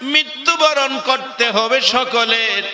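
high chanted preacher's call, easing down at the end; tuneful sermon warning; rousing exhortation. Real, not acted. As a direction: summon the congregation, then bring the question down. Exhorts the congregation.

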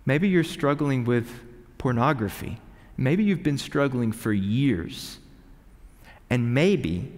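usual speaking voice

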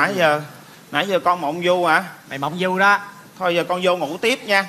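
low-pitched, soothing explanation; low, measured coaxing back to bed; calming cover-up. Acted. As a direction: talk it down in a low voice. Covering up, sending child to bed.

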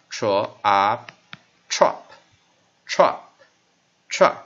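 "trop" in loud, slow, separate repeats with a short vowel; short-vowel blending drill. Shown as a demonstration. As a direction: sound out "tro-up", then blend it into "trop". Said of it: "这是我们做个短母音这样子过来".

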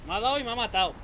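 frustrated shout. Real, not acted. Character just died.

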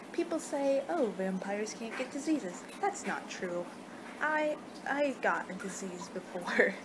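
usual speaking voice

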